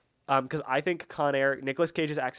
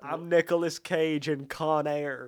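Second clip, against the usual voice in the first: slow mock Southern Con Air drawl; slow Southern accent like Cage in Con Air; slower than usual. Friend imitates Cage's Con Air Southern accent. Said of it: "You're not great at the accent".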